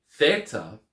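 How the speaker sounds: Theta begun with unvoiced th as in thin, THEE-tah; hard th Theta. Shown as a demonstration. Teacher modelling Theta with hard th. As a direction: start Theta with the breathy th of thin. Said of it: "T H sound as in throne or thin".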